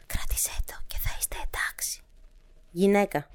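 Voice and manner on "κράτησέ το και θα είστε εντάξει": soft, fully whispered instruction; whispering. A narrator's reading. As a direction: whisper it as the woman. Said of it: "ψιθυρίζοντας". (ψιθυριστά)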